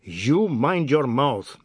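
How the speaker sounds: slow, loud warning; angry shout. A narrator's reading. Mister Biswas warns the insulting man, slow angry shout. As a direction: shout it with weight on each word. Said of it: "Mister Biswas shouted".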